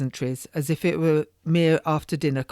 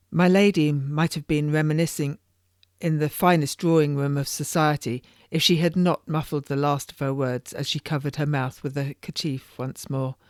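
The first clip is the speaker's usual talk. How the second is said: ordinary delivery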